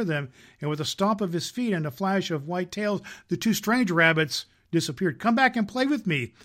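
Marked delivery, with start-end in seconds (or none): 5.2-6.3 cried. A narrator's reading. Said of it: "cried the little rabbit".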